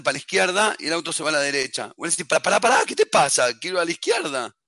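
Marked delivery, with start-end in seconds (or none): 2.3-4.5 loud, quick burst of disbelief; alarmed protest. Acted. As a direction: stop the car that defies you. Driver protests to disobedient car.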